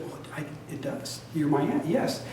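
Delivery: breathless, almost voiceless admission; dazed near-whisper; hushed stunned reply. Retold or imitated. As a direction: let it slip out barely voiced. Stunned reply to new aunt.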